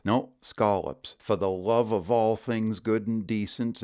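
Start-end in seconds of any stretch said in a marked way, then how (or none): 0.4-1.2 scallops with the plural s; the outsider's scallops; wrong way. Shown as a demonstration. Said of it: "it's not scallops".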